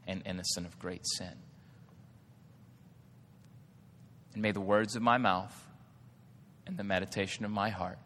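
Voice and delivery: quiet scripture prayer, slowing and softening. Acted. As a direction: let each phrase fall away gently. Reads psalm as prayer.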